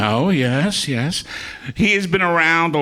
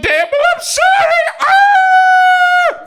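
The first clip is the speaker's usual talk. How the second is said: high, loud wailing Laurel cry; Stan Laurel cry; 7 dB louder, much slower than usual. Acted. Comic ending the Laurel impression, high loud wail. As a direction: burst into a high Laurel sob. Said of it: "I'll do Stan Laurel as Prissy the maid".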